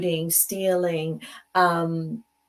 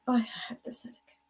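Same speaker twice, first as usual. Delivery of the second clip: fast, softer whispered complaint; whiny headache whisper; 5 semitones higher, 7 dB quieter, much faster than usual. Retold or imitated. A chronic complainer whispers about her headache again. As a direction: moan it under your breath. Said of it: "people that always have a headache".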